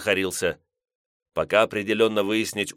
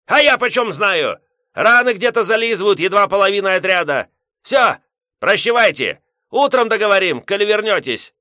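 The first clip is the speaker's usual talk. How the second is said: put-on grumpy old man; 11 semitones higher, 8 dB louder than usual. Headman yelling.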